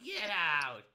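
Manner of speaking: put-on very old woman. Old lady orders them out.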